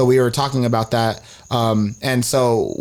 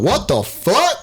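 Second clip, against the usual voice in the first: shocked what the fuck; 8 semitones higher, much slower than usual. Retold or imitated. Shocked at friend's news.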